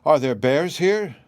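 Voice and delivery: put-on drawn-out, mildly alarmed girl's question; startled question. A narrator's reading. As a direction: ask in wide-eyed surprise. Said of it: "exclaimed Dorothy".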